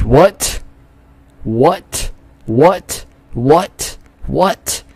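each word swooping up as a question; short, high rising yelps of confusion; baffled disbelief. Real, not acted. Baffled by what the game shows.

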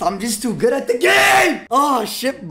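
loud, high bragging yell; excited shout. Real, not acted. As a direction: shout it out, cocky and thrilled. Gamer boasting after win.

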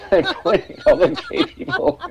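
high, loud words through laughter; laughing hard. Real, not acted. Guest telling friends a stage story, laughing through the words. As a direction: crack up while telling it. Cracking up at his own story.

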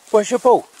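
high, sing-song dog-talk voice. Real, not acted. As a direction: coax the dog, all cheerful. Talking to the dog.

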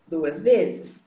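normal talking voice

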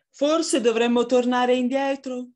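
worried storybook fox; long fox, hesitant. Acted. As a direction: suggest retreat in a high fox voice.

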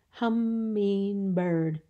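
"Hummingbird" very slow, in three even beats; syllable by syllable. Shown as a demonstration. Teacher showing three syllables, "Hummingbird" slowly stretched in three. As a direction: draw out each syllable of "Hummingbird". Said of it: "Three syllables".

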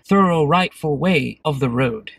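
loud, stiffly proper complaint; overly formal polite driver. Acted. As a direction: play a prim, polite motorist. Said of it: "that little skit I just thought up".

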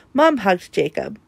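plain reading voice

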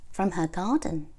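plain reading voice

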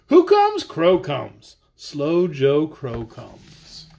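lively sing-song read-aloud; reading to children. Acted. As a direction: read the rhyme playfully to kids. Reads tongue-twister book aloud.

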